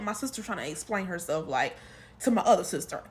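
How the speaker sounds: normal talking voice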